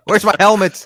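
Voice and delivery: laughing through the joke; giggling mock panic. Real, not acted. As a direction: ask it while cracking up.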